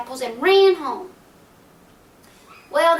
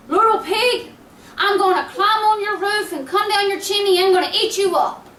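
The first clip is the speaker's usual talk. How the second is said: higher and louder, furious wolf threats; angry wolf; 6 semitones higher, 5 dB louder than usual. Acted. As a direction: bellow it like a wolf losing his temper. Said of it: "this made the wolf so angry".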